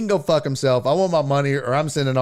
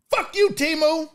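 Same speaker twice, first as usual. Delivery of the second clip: angry outburst; 10 semitones higher than usual. Real, not acted. Shouting at the app.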